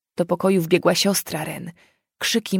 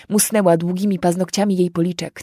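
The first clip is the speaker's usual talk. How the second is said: plain reading voice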